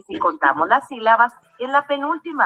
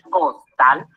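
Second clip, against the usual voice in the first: "costal" en dos golpes, cos y tal, más grave; separado en sílabas; 9 semitones lower than usual. Shown as a demonstration. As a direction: separa "costal" con pausa entre sílabas. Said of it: "Al separarla en sílabas". ("costal" silabeado para enseñar)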